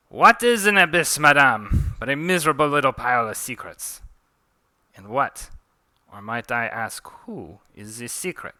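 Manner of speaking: put-on French-accented declamation, loud then easing; theatrical French-accented riddle. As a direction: proclaim it in a mock-French accent. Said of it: "Forgive my French accent".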